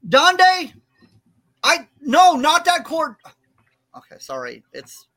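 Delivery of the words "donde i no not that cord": high, loud scolding call; urgent, high-pitched telling-off; alarmed yell. Real, not acted. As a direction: shout across the room to stop a mishap. Calling out to stop Donde.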